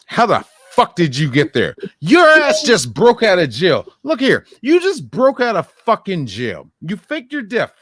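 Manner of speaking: loud, high exasperated ranting; angry rant. Real, not acted. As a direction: yell your disbelief at the character on screen. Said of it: "I was going off on a rant".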